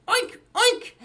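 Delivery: high-pitched playful pig oinks. Pretend. Host sings about pig on farm, oinking high. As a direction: squeal it like a pig. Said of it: "he makes a sound like this".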